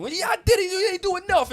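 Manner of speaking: high loud mock argument; arguing brothers. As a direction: yell it like a sibling bickering. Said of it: "They still arguing".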